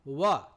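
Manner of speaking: ওয়া begun with rounded lips, as an English W; w sound. Shown as a demonstration. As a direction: say ওয়া with a clear falling W glide. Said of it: "দেখো উচ্চারণ করলাম ওয়া".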